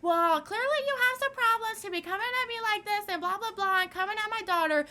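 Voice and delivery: high, mocking whiny voice of the mom; mocking the woman. Teen mocking the angry mom, high mocking voice. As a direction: mimic her in a high nasal sneer. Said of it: "And she was like".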